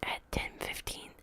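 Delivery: plain reading voice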